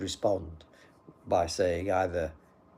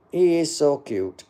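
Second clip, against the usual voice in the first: higher, louder mock admirer; mock gushing; 7 semitones higher, 9 dB louder than usual. Streamer reading a viewer's compliment back, mock gushing. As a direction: coo the compliment loudly, hamming it up.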